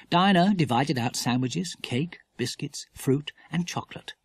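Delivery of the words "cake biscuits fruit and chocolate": plain reading voice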